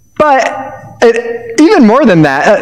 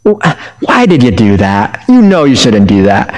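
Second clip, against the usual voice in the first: a hint of a let-down parent, pitched lower; disappointed parent; 7 semitones lower than usual. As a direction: gently scold like a disappointed parent. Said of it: "like that disappointed parent".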